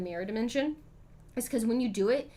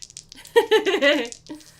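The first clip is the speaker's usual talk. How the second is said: bursting, loud laugh; 6 semitones higher, 13 dB louder than usual. Real, not acted. Streamer laughing at being told she sounds French.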